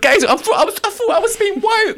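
high, laughing, teary parent; mock crying parent. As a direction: wail it out through laughter. Parent voiced, laugh-cry.

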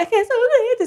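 high wailed plea; imitated panicked cry. Aunt desperate after another tremor, high mock wail.

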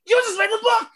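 high, hammed-up announcer call; playful high-pitched proclamation; mock announcement. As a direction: proclaim it high, as a joking verdict. Mock announcement of book entry.

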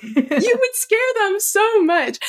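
giggling at her own unspoken comeback; laughing inner thought. Retold or imitated. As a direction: let the laugh leak through the thought she never said. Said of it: "And in my head, I'm like".

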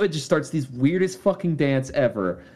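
ordinary talk, nothing marked

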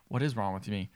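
low, subdued bewildered question; quiet start of a retold freak-out; retold self-questioning. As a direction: ask it softly, lost and confused. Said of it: "Starts freaking out".

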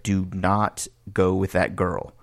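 each word slow and weighted; slow emphatic warning. Retold or imitated. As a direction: spell it out like a stern friend. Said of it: "as he's saying these syllables".

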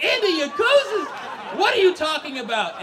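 high, loud squawk of disbelief; incredulous outburst. Acted. Son answering dad's wild accusation, incredulous outburst. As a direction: blurt it out, utterly baffled.

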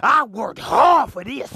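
guard your woman, half laughing; protective man. Retold or imitated. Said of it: "A real man gonna be like".

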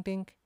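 high-pitched dink sound effect; ping it out like metal struck; imitated dink. Mimicking the metal noise on his car, high dink.

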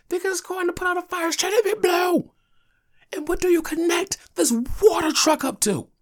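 high-pitched, loud indignant yelling; worked-up, shouted retorts in a retold childhood argument; arguing. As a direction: hammer the class with outraged questions. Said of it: "I argued the whole class down".

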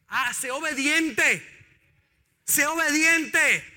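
slow, emphatic exhortation; preaching. Real, not acted. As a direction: drag out each word to drive it home. Preacher exhorts slowly, loudly. (predicador exhorta lento y enfático)

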